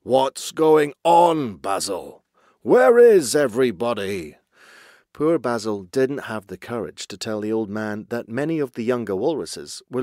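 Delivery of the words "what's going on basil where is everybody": put-on loud, drawn-out grumpy old chief; peevish grunt. A narrator's reading. Old walrus chief summoning Basil about missing herd, peevish grunting. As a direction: demand it in a big, testy character voice. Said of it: "he grunted peevishly".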